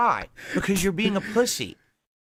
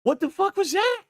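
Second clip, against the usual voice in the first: mocking squeak; 13 semitones higher than usual.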